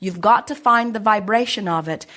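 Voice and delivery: ordinary delivery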